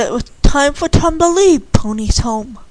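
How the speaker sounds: ordinary delivery, nothing marked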